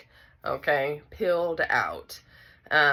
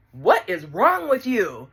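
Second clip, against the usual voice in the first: indignant; 4 semitones higher, 4 dB louder than usual. Retold or imitated. Retold crowd scolding the screaming sister.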